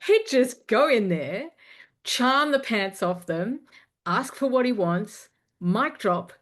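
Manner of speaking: loud, high, slow swaggering bravado; Motherfucker MC impression. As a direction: channel a cocky MC strutting in. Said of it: "what would motherfucker M C do?".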